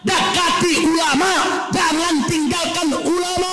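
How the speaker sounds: high, insistent oratory held on one pitch; impassioned preaching. Acted. Preaches at full force. (khotbah bersemangat dengan nada tinggi)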